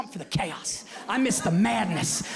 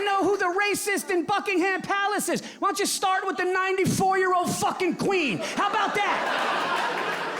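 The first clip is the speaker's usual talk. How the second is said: jab at them, voice up high; ranting shout; 11 semitones higher than usual. Real, not acted. Ranting at royal family.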